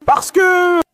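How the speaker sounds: high, loud drawn-out outburst; upset shout. Real, not acted. Suspect answers the officer's challenge, upset shout. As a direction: shout it out, pitched right up.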